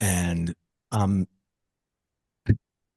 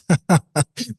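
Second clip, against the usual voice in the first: mock chuckle, pitched up; high playful laugh; 12 semitones higher than usual. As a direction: imitate her light chuckle. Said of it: "she chuckles and goes".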